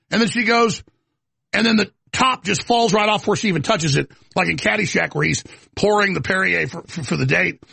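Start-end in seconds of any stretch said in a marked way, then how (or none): none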